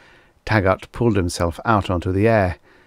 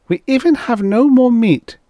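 high, loud complaint; vexed exclamation; 6 dB louder than usual. A narrator's reading. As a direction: complain loudly, pitched well up. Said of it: "Reek exclaimed vexatiously".

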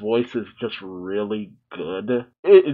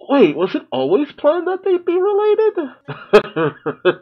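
surprised laughing realization; 13 semitones higher, slower than usual. Real, not acted. Sudden realization while watching.

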